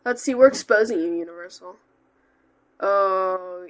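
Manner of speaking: ordinary talk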